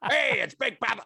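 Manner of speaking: high, loud Italian American voice; hammed-up Italian American accent; Italian American impression. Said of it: "that was meant to be Italian American".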